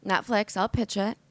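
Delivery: usual speaking voice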